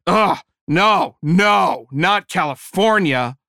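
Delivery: loud, disgusted groan at the word. Retold or imitated. Church lady recoiling at California, loud disgust. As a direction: recoil like you found cockroaches. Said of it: "in the same tone you'd use if you found a nest of cockroaches".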